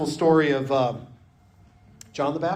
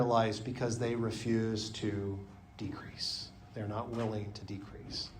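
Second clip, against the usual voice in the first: whisper; 5 semitones lower, 12 dB quieter than usual. Acted. Preacher drops to whisper.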